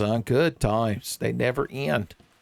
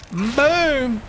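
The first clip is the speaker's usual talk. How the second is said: higher, louder triumphant yell on Boom; excited yell; 11 semitones higher, 3 dB louder than usual. Real, not acted. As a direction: shout it as the shot lands. Blasting enemies in game.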